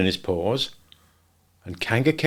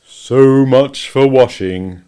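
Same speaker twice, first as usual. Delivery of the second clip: very slow, louder gloomy drawl; slow Eeyore, louder; 3 semitones higher, 8 dB louder, much slower than usual. A narrator's reading. Eeyore remarking as Roo falls in, slow and gloomy. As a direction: sigh it out like a weary donkey.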